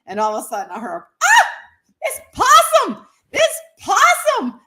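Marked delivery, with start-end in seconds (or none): 1.2-4.6 high, loud, drawn-out mock shriek; stretched-out, hammed-up cry of alarm; horrified discovery. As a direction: imitate mama shrieking in horror. Mother discovers the fur is possum.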